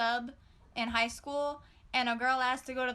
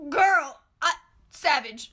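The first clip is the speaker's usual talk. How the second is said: amazed yell; 2 semitones higher, 9 dB louder than usual. Real, not acted. Storyteller reacting to the classmate's comeback, loud amazed. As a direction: shout it like you can't believe it.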